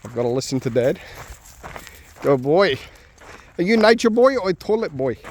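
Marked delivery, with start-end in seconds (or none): 2.2-5.2 much higher, sing-song pet voice. Real, not acted. Owner cooing at his dog Shadow in baby talk.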